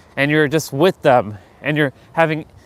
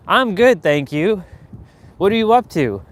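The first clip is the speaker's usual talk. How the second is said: higher-pitched polite caller; superficial small talk; 4 semitones higher than usual. Acted. The caller in a dull conversation, higher and mock-polite. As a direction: make empty, polite chit-chat. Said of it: "they're boring, and they're monotonous".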